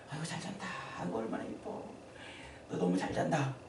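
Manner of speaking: put-on soft, hushed cooing over a sleeping baby; tender parent. Parent gazing at a sleeping child, cooing softly. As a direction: coo softly so the child won't wake. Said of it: "그러잖아요".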